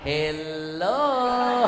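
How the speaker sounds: loud sarcastic drawn-out hello; mock the listener with a knowing hello. Shown as a demonstration. Preacher mocking sinners to the mothers, sarcastic. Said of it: "sengaja saya bikin kalimat sindiran hello".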